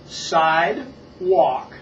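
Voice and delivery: loud, very slow, split syllables; slow model. Shown as a demonstration. Teacher introduces a new word to Paul, slow and separated. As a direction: stretch "Sidewalk" into two separate held parts. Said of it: "I will say it slow".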